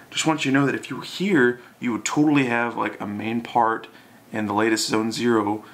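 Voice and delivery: ordinary talk, nothing marked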